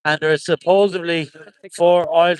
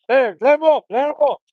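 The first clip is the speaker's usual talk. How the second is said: calling out; 7 semitones higher than usual. Real, not acted. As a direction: shout it out to the lads on the street. Calling out at live scene.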